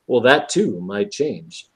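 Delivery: low, slow, measured comeback; unhurried, lightly acted veiled threat; cool mock reply. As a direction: answer the protest slowly and low, as the one in charge. Voices Ma'ab's answer to the protest.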